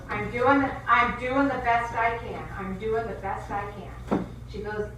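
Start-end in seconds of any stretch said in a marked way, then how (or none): 0.1-2.4 exasperated Kathy. Retold or imitated. Coworker rolling her eyes, answering loudly in exasperation. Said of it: "and she goes". 2.6-4.2 slow, deflated echo; weary repeat. Retold or imitated. Coworker repeating herself, slow and weary after the outburst. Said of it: "she rolls her eyes back in her head".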